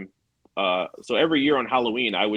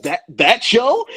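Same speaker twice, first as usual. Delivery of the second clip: high, loud, drawn-out disbelief; mock-stunned character reaction; astonished question; 11 semitones higher, 7 dB louder, much slower than usual. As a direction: blurt it slowly, pitched way up.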